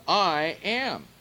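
preacher hammers home "I am."; emphatic I am. Shown as a demonstration. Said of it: "Now notice the emphasis of this".

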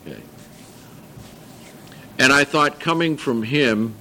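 ordinary delivery

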